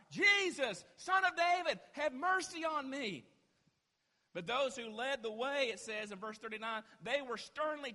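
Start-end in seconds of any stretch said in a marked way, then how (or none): 0.1-3.2 high, loud pleading shout; desperate cry. Acted. Blind man in need of God, crying out desperately. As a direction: call out with everything you have. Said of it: "desperately needed God".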